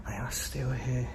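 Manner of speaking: slow, hushed whisper. Real, not acted. Ghost hunter warns viewers in a slow whisper. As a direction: breathe it out slowly so nothing hears. Sensing presences in the building.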